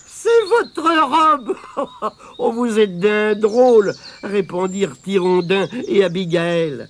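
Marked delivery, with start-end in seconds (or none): 0.1-3.9 laughing. Acted. Laughing at Denis's robe.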